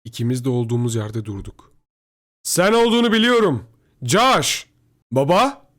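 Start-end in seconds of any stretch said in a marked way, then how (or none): 2.4-4.7 high, loud shout across a distance; calling out. A narrator's reading. Father calls from across shelter. 5.1-5.7 loud, high, questioning call; shouting back. A narrator's reading. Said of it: "Ben de bağırdım".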